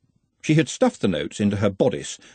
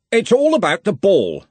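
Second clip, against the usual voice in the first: put-on loud, hearty old wizard; booming, confident wizard boss; bluff archchancellor; 10 semitones higher, 6 dB louder than usual. A narrator's reading. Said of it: "said Ridcully".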